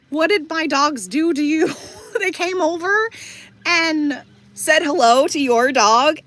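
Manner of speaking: high-pitched, amused disbelief; mock-indignant question, voice pushed up and breaking into a laugh; incredulous protest. Dog owner answering the upset woman, incredulous protest.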